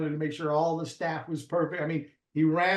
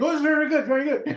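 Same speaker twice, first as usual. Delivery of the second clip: meek diner; 11 semitones higher, 6 dB louder than usual. Retold or imitated. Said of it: "How's everything?".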